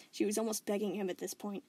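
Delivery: plain reading voice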